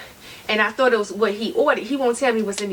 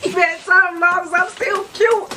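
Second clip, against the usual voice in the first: high, louder, laughing through it; laughing protest; 7 semitones higher, 4 dB louder than usual. Real, not acted. Wife laughing as she insists she is cute. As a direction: giggle your defense.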